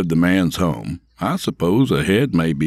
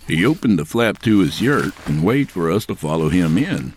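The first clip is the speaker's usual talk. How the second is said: usual speaking voice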